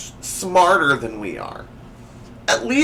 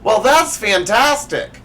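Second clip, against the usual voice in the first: gushing mom; 7 semitones higher, 5 dB louder than usual. Acted. Mom praising chem grade.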